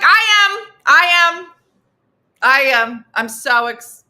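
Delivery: high, loud eager yelling; over-eager shout. Retold or imitated. Friend answering a rhetorical question, loud and eager. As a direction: shout it like a superfan.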